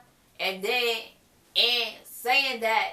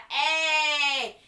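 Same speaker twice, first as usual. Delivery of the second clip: high, drawn-out cheer; celebratory cheer; 5 semitones higher than usual. Real, not acted. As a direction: cheer it out, higher pitched. Cheering that William got it.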